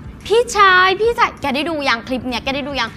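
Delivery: lightly voiced cute dubbing; a hint of the Korean clip voice; mock Korean clip voice. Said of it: "ชอบคลิปเขาเนี่ยแหละ แล้วก็แบบ".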